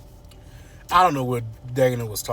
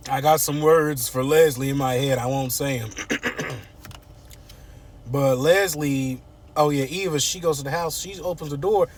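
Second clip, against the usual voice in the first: ordinary talk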